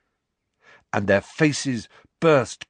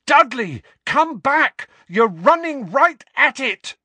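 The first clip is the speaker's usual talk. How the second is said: high, loud warning shout; desperate yelled warning; urgent shout; 8 dB louder than usual. A narrator's reading. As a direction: call after someone running into danger. Harry shouts after fleeing Dudley.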